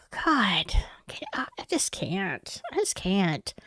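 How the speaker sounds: breathless whisper of disbelief. Real, not acted. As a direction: drop to a stunned whisper. Overwhelmed by case, speaks hushed.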